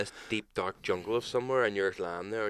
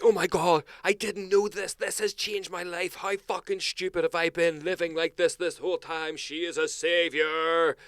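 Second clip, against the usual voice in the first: higher, mock-awed fan voice; mocking gushing fans; 8 semitones higher than usual. Mocking fans gushing over a cleaning influencer, in a higher mock-awed voice. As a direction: ham up the gushing internet crowd. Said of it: "the whole internet were like".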